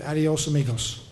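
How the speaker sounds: put-on a light Spanish accent on the farewell. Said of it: "Spanish accent you had there was very good".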